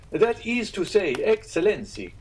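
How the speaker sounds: high, slow, dignified landlord; innkeeper voice. Acted. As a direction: draw it out with wounded dignity. Pastrini defends Rome.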